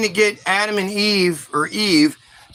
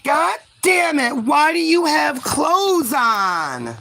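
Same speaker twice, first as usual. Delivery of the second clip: high, drawn-out mock howl; hammed-up horrified shouting, slow on the question; outraged God; 8 semitones higher, much slower than usual. God outraged on seeing them clothed.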